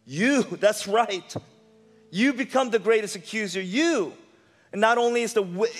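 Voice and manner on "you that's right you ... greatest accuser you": usual speaking voice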